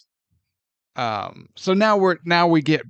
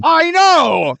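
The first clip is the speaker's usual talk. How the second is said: high, loud burst of agreement; exasperated agreement; 13 semitones higher, 6 dB louder than usual. Real, not acted. Host agreeing with co-host's jab, exasperated high outburst. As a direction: cry out that you couldn't agree more. Agreeing loudly that the impression fits.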